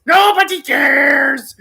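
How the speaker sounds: high, slow, shouted parrot screech; a shrieking bird stretching out the words. Retold or imitated. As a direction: screech it like a parrot mocking you. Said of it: "if I use this coping mechanism".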